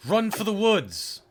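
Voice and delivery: loud high shout. Acted. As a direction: yell it out to the group. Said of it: "I'm just gonna shout out".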